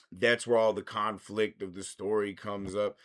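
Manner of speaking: ordinary talk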